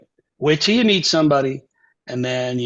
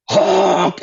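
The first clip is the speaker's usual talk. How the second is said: long honk, pitched up and pushed; imitated saxophone honk; 4 semitones higher, 4 dB louder than usual. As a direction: mimic a barely controlled horn note. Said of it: "Play a low B flat".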